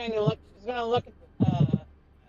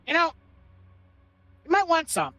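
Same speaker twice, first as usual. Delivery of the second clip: character voice; 6 semitones higher than usual. Acted. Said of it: "She's like".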